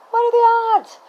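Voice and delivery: high squeal of surprise; amazed. Real, not acted. Amazed squeal to viewers about the coincidence. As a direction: marvel at the coincidence, pitch way up. Excited aside about shared hometown.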